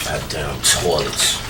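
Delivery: low, slow, quiet muttering; grumbling to himself. Acted. Cleaner grumbles to himself over dirty toilets, low muttering. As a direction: mutter wearily under the breath.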